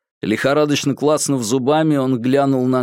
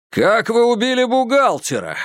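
shouting; 11 semitones higher than usual. Acted. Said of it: "крикнул Курц".

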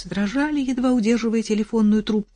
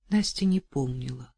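usual speaking voice

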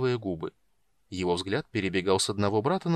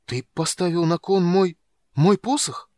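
higher and louder, the second мой stumbling out in shock; the young mage can barely get the words out; higher, louder horrified question; stunned disbelief; 8 semitones higher, 7 dB louder than usual. A narrator's reading. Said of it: "Юноша едва мог говорить".

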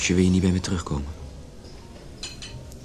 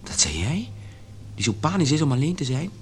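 incredulous; 9 semitones higher than usual. Acted. Incredulous reproach to leaving wife.

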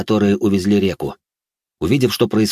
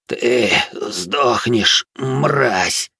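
very slow, laboured dying curse; strained, drawn-out threat with failing breath; dying, heavy-breathed whisper; 3 semitones higher, much slower than usual. A narrator's reading. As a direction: force out each word as if dying. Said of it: "тяжело дыша прошептал умирающий".

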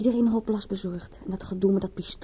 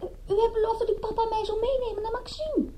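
much higher, sing-song coaxing with a laugh; spoilt daughter; 13 semitones higher than usual. Acted. Paula reminds papa of his promise to take her out, high coaxing. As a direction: wheedle papa like a pampered girl.

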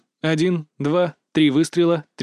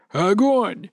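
raised-pitch call to fire; shouted command; 4 semitones higher than usual. A narrator's reading. Commander to grenade launcher man, high shouted order. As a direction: call it out as an urgent battle order. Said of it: "крикнул он гранатомётчику".

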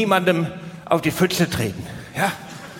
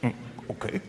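breathy whispered, unsure agreement; tiny whispered okay from a nervous date; 6 semitones lower, 13 dB quieter, faster than usual. Acted. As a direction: barely voice it, nervous and giving in. Hesitant reply in the scene.

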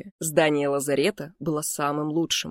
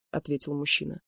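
ordinary delivery, nothing marked

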